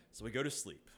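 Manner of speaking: ordinary talk, nothing marked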